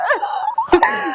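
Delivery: high squeal of laughter. Real, not acted. Teasing co-host about pirates, high squealing laugh.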